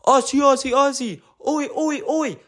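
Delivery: high-pitched mock Australian fan chant; mock screamed sports chant; imitated crowd screaming.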